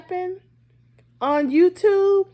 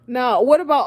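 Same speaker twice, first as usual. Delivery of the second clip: ordinary delivery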